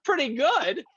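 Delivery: high offended pretty good; indignant echo. Retold or imitated. Voice actor retorting to lukewarm fans, high mock indignation. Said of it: "I'm like".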